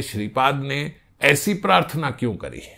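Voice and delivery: ordinary talk, nothing marked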